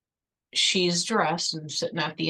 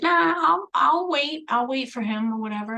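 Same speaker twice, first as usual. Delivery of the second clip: pushy friend; 8 semitones higher, 4 dB louder than usual. Retold or imitated. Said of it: "And he's like".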